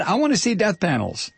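high-pitched, petulant mock demand; mock indignation. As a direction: insist like a hammed-up tantrum. Demand repeated as mock tantrum.

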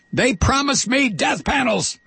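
high, hammed-up protest; mock indignation. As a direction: wail it in mock betrayal. Mock tantrum over broken promise.

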